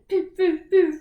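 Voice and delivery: high, loud whimpers; whimpering. Acted. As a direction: whimper like a hurt pup. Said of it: "whimpered the fox".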